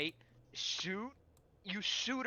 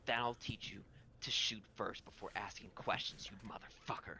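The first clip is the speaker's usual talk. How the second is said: low, quiet threat, dropping to a whisper; lightly voiced villain, pitched down and hushed at the end; menacing jester woman; 6 semitones lower, 5 dB quieter than usual. Game master as jester woman scolding the revived player, low hushed threat. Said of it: "She goes".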